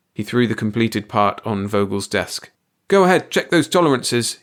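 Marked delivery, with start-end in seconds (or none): 2.9-4.4 said whitely. A narrator's reading. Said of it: "he said whitely".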